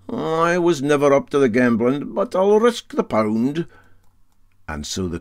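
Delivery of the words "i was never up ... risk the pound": put-on raised, hearty old skipper voice; Para Handy's voice. Captain taking up the bet, hearty and raised. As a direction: bluff Highland captain boasting.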